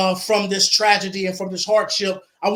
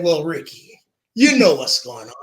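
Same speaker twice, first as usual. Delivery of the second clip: knowing lawyer voice; 5 semitones lower than usual. Retold or imitated. Lawyer Sean breaking the news.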